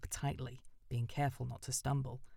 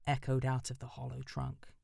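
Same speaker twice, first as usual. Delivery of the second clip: usual speaking voice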